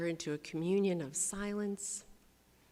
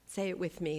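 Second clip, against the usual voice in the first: ordinary delivery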